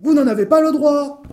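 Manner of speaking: high, loud angry ruling; furious shout. Acted. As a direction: shout the ban in a rage. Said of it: "parut frapper de folie furieuse".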